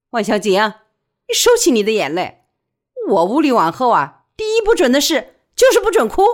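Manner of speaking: loud, forceful order; stern command. A narrator's reading. Widow orders friend to stop crying.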